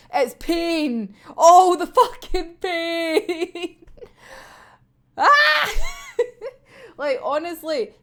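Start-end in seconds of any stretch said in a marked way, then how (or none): 0.1-3.8 drawn-out, louder wail of pain; theatrical anguish breaking into laughter; mock agony. Mock agony over the chapter. 5.2-5.7 short, high, loud "ah!" scream; playful shriek that tips into laughter; mock scream. Mock scream at painful chapter.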